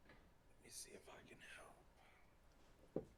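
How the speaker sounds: soft unvoiced whisper to self. Real, not acted. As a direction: murmur it under the breath. Whispering while fixing mic.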